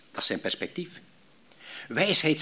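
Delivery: ordinary talk, nothing marked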